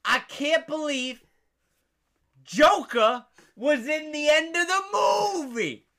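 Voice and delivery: loud, high, drawn-out mock amazement; retold gloating. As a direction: crow it slowly for the whole room to hear. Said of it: "he was gloating".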